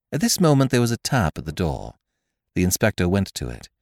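ordinary delivery, nothing marked

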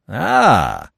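loud, high exclamation from Stoner; Stoner, emphatic. Acted. Stoner answering the magistrate, loud and emphatic. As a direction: pounce on it, loud.

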